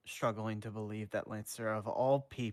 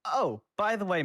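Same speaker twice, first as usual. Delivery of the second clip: put-on higher, louder teen voice; high voiced Danny; 11 semitones higher, 9 dB louder than usual. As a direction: pipe up like a sly teenager. Danny voiced, casual opener.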